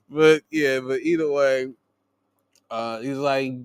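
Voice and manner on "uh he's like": normal talking voice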